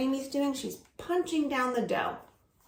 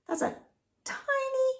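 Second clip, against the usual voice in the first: tiny squeak. Shown as a demonstration. Teacher shows tiny cupcake.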